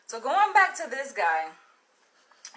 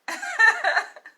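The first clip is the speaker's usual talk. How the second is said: knowing laugh; 12 semitones higher, 6 dB louder than usual. Real, not acted. Laughing at his answer.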